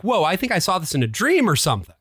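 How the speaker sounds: ordinary talk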